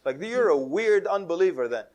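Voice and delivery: laughing mock accusation; teasing incredulity. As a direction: rib her loudly, amused. Teasing the doubting girl.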